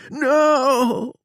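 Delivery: high, loud shriek of no; panicked refusal, high and loud; terrified no. A narrator's reading. Bob refuses the hip check.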